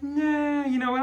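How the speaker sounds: high, drawn-out dismissal; mock dismissive. Phil decides against saving Ellis, mock dismissive. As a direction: wave the idea away, imitated. Phil drops the rescue idea, retold.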